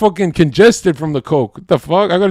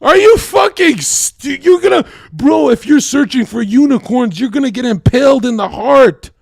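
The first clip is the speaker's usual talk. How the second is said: shouting rant; 8 semitones higher than usual. Real, not acted. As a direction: yell it at them, worked up. Heated rant at the co-host.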